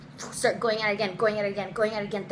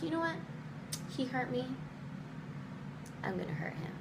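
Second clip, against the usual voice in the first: soft, hurt resolve; hushed, retold angry decision; quietly vengeful; 4 semitones higher, 11 dB quieter, faster than usual. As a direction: murmur a wounded vow of payback. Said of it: "anger and pissed offness and heartbrokenness".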